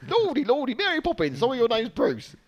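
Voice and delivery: put-on high, loud botched Australian accent.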